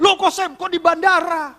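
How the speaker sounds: high, loud surprised greeting; acted surprise. Pastor meeting old friend at airport, surprised. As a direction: exclaim at an unexpected meeting.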